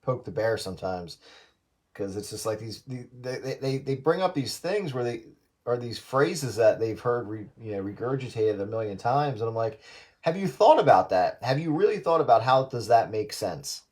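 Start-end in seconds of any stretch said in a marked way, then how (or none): none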